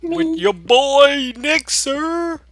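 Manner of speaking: high, slow showman call; hammed-up announcer. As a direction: ham it up like a host.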